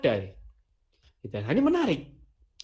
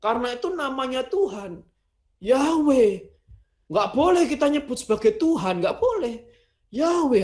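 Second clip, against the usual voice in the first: higher, insistent voice of the purists; imitating those who insist on Yahweh, raised and emphatic; mock insistent believer; 6 semitones higher than usual. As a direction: lecture them that only Yahweh is allowed. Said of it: "yang menganggap bahwa".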